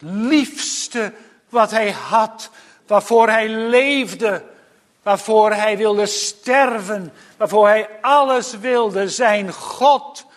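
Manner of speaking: hoge, krachtige preekstem; vurige preek. Acted. (vurig en met verheven stem prediken)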